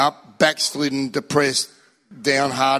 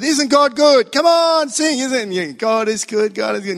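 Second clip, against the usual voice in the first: song leader; 6 semitones higher than usual. Retold or imitated. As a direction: pump up the crowd with joy. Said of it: "song leader said".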